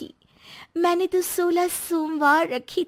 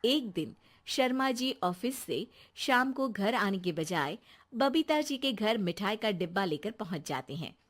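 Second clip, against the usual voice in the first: lower, softer narrator voice that fades away; story narration; 6 semitones lower, 8 dB quieter than usual. A narrator's reading. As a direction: tell it like a storyteller, dropping at the end. Narrator introduces scene. (कहानी सुनाने वाली, नीची और धीमी आवाज़)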